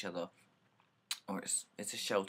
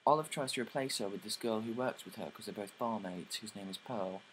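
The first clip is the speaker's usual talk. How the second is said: ordinary talk, nothing marked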